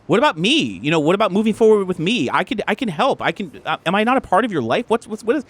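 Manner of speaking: high, urgent pleading; upset, insistent protest; hurt girlfriend. Acted. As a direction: argue for your place, pitch high.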